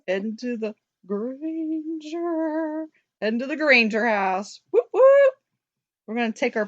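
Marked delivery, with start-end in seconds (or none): 0.1-2.9 slow, stretched "Granger"; drawn-out hype. Pretend. Host hyping the trip to the Granger house, drawn-out excitement. 4.7-5.3 high, loud celebratory whoops; excited cheer. Real, not acted. Host cheering the Granger house trip, high excited whoop.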